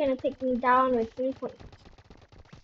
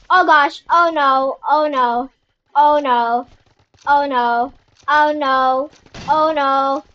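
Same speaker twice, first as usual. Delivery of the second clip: panicked oh no; 7 dB louder than usual. Real, not acted. Losing first place mid-game.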